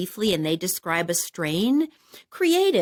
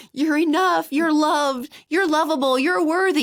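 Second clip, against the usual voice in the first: affirming warmly; 8 semitones higher, 4 dB louder than usual. Retold or imitated. Affirmations from the high.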